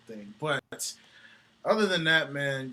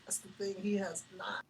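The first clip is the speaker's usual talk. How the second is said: whispered aside; 4 semitones higher, 8 dB quieter than usual. Real, not acted. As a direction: drop to a breathy whisper for emphasis. Repeating the answer as a whisper.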